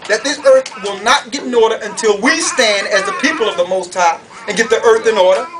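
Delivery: ordinary delivery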